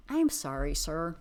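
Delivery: ordinary talk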